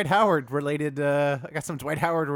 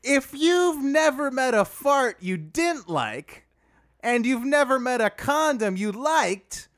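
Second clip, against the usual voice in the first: Dwight Howard voice; 7 semitones higher than usual. Pretend. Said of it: "can we dial that accent up a little".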